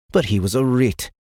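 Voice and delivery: ordinary delivery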